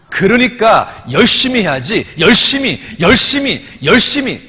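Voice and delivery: loud, high mimicry of an exasperated church deaconess; imitated scolding outburst; indignant retort. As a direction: hammer out each repeat, loud and high. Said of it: "드디어 본색이 딱 드러난 거예요".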